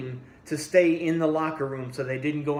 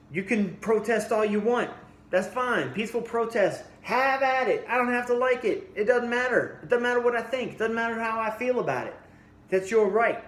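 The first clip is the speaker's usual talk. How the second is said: higher-pitched, insistent retold argument; arguing; 8 semitones higher than usual. Retelling his argument with family over free speech, insistent. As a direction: argue the point, pitched up and pressing. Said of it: "And I argued that".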